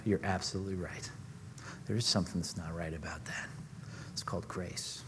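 hushed whisper, quick and soft; a private thought breathed under the breath; whispered inner thought. Retold or imitated. Preacher voicing his inner reply to the caller, whispered. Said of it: "I thought to myself".

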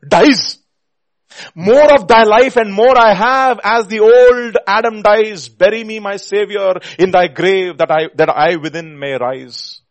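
loud, high, urgent hymn declamation; full-force preaching. Real, not acted. Preacher pleading Wesley's verse to his Savior, full force. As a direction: proclaim at full voice, easing at the last phrase.

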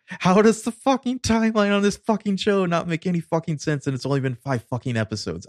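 high-pitched, ranting outburst; exasperated sweary tirade; exploding in exasperation. Real, not acted. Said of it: "this is where I just exploded".

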